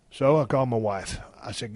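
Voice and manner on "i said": normal talking voice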